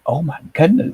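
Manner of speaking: high, startled little gasp; imitating shocked gasp. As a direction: play the stunned king caught off guard. He's, like, shocked.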